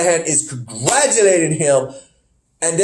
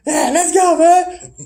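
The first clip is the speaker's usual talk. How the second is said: mock hype; 12 semitones higher, slower than usual. Acts out wrestler celebrating.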